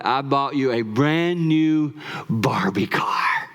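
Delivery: slow, hushed whisper; secret whisper. Retold or imitated. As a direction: whisper the surprise to a child. Telling granddaughter the surprise.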